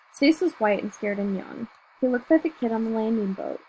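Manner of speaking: ordinary delivery, nothing marked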